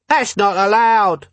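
slow, high-pitched heckler; objecting bystander. Acted. Voice in the crowd to troll, high protest.

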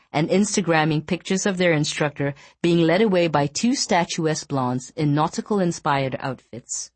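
ordinary delivery

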